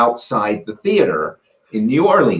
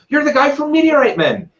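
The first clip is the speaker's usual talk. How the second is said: high, thrilled fan outburst; excited fan; 12 semitones higher than usual. Retold or imitated. Fan recognizing the meteorite hunter, thrilled. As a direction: blurt it out starstruck. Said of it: "he goes".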